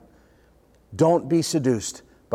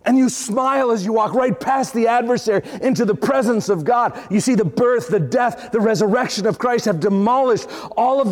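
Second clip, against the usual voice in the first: raised, driving preacher's voice; preaching at full force; 5 semitones higher than usual. Acted. Pastor rousing his congregation, preaching at full force. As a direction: preach it out to the whole church.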